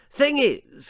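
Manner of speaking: high, loud upper-class bluster with barking "What?"; pompous toff sizing up the natives; hunting lord introducing himself; blustering posh lord. A narrator's reading. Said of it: "continued Lord Percy".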